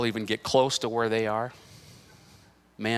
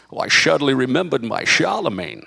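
put-on Scottish accent with slushy s sounds; Sean Connery; 5 dB louder than usual. Father answering his son in the film, Scottish Connery accent. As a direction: play the Scottish movie dad, slurring each s. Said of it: "The one with, with Sean Connery".

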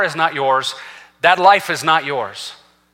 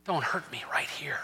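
a sudden breathy whisper; hushed whisper; 2 semitones lower, 15 dB quieter than usual. Real, not acted. Preacher voicing God's pain to the congregation in a hushed whisper. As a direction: drop to a whisper to draw them in.

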